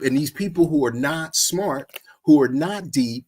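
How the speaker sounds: ordinary talk, nothing marked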